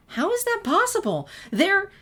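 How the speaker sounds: high, loud astonished cry; can't believe what she just heard; shocked disbelief. Acted. Twilight reacts to Sunset never hearing of Prancy Drew, astonished.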